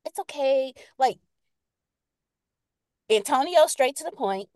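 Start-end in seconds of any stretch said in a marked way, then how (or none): 0.1-0.7 leise, langsam, tröstend wie zu einem Kind; soothing sugary voice. Retold or imitated. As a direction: ganz sanft beschwichtigen. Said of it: "But then you'll have some, like".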